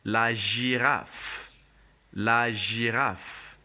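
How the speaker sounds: "la girafe" drawn out for repetition, zh not g; correct French pronunciation. Shown as a demonstration. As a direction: stretch "la girafe" out as la zhee-raff. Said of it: "So we say la girafe".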